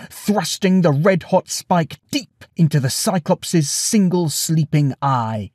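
usual speaking voice

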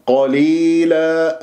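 قليلا slow, qaf thick with open a; heavy open qa. Shown as a demonstration. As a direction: say قليلا with qa not qo. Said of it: "لا، قا".

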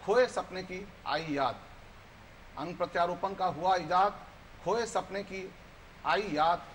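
measured verse with repeated lines building in force; poem recitation. Acted. Guest recites his poem on Eklavya's thumb, building poetic recitation. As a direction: recite it rhythmically, pressing the refrain harder.

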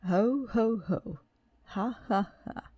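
booming ho ho then ha ha; hearty laugh. A narrator's reading. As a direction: laugh big like a jolly king. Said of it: "he laughed some more".

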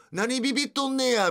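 high mock thug in clumsy Kansai dialect, 何ビビっとんねや sneered; bad Kansai dialect. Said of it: "下手くそな関西弁で".

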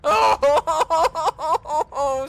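high, loud fake sobbing; mock sad wail. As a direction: wail in hammed-up misery. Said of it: "sad face".

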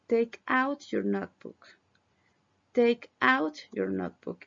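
ordinary delivery

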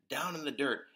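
usual speaking voice

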